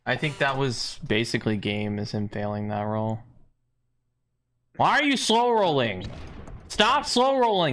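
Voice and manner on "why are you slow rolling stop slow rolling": high, pleading exasperation; exasperated complaint. Real, not acted. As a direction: whine at them to hurry. Opponent delays reveal in game.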